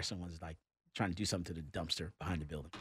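ordinary talk

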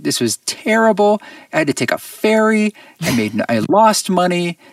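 mock-wail how bad the gig was; a hammed-up voice of his younger self, crushed after a failed gig; imitating his despairing past self. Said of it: "so depressed and angry afterwards".

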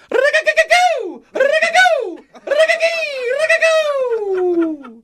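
very high, rolled bird-like whoops; imitated alert sound. Shown as a demonstration. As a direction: trill it, then swoop into a drawn-out goo. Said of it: "the third sound".

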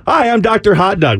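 goofy intro voice. Pretend. Mock introduction to neighbors.

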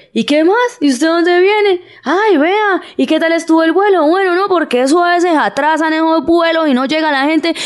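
high mock-crying Santander accent, scolding as it climbs; tearful, scolding Santanderean. Cúcuta taxi driver greeting a passenger, tearful scolding Santanderean accent. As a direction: whine and scold in one breath, Santanderean style. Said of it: "en la misma frase le lloran y le, y lo regañan".

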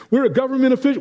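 high-pitched mock recital; imitating churchgoers' automatic agreement; congregation chiming in. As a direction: recite it high as the believers would, before doubting it. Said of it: "and we all say".

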